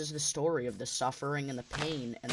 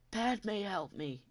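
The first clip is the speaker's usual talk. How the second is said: high strained cry that fades much softer on help; muffled scream; 7 semitones higher, 4 dB quieter, much slower than usual. Retold or imitated. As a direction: choke the plea out through a mask, barely audible. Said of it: "it's so muffled because his lungs are so heavily charred".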